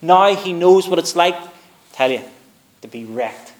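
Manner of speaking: preaching at full force. Acted. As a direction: drive it home to the congregation. Sermon climbs to full preaching.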